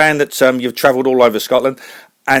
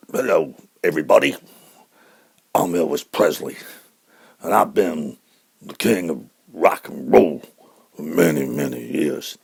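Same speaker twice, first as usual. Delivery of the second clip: [name]; 11 semitones lower, 10 dB quieter than usual. Acted. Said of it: "could you do anything, Elvis, now?".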